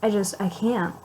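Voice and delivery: normal talking voice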